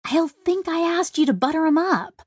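high-pitched embarrassed protest; squeaky mock scolding; embarrassed Kizzy. A narrator's reading. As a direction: protest in a high, girlish character voice. Embarrassed Kizzy scolds Bear playfully.

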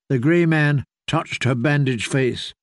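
normal talking voice

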